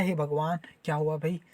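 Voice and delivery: low, soft acted appeal to God; hushed, lightly acted worry; murmured worried prayer. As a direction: drop pitch and volume as if praying privately. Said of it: "ऐसा type से".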